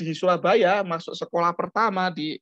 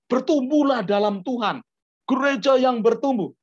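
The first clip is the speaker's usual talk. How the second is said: mock preacher; 6 semitones higher, 4 dB louder than usual. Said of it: "jangan tiba-tiba".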